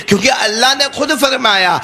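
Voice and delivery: ordinary delivery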